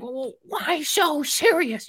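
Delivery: very high, slow Joker voice; Joker impression. Quoting the Joker to a co-host, very high and slow. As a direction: drawl it like a menacing clown. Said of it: "He's like".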